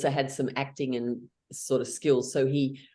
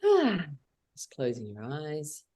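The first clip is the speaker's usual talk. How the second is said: guided meditation; 2 semitones lower than usual. Acted. Leader guides the group through a calm meditation. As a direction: guide them gently inward.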